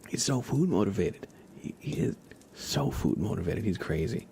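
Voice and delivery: ordinary talk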